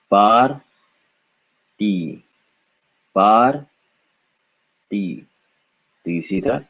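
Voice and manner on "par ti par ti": "Par" rising, pause, then falling "ti"; syllable by syllable. Shown as a demonstration. Teacher breaking up the word, "Par, ti" syllable by syllable. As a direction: sound out "Par" and "ti" separately. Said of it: "This would be par, followed by".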